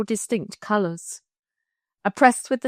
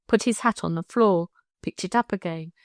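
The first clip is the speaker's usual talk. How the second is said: plain reading voice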